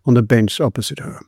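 ordinary delivery, nothing marked

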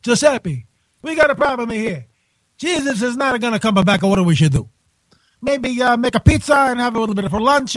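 mock Italian accent; hammed-up Italian cardinal. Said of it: "how about an Italian accent?".

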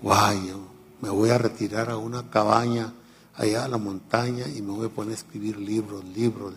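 ordinary talk

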